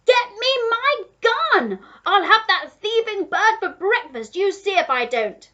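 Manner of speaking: high, loud vengeful rant; duke shouting. A narrator's reading. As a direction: yell threats and orders at the gardener. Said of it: "the Duke was shouting to the gardener".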